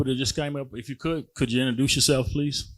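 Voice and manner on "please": ordinary delivery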